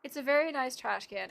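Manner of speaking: ordinary talk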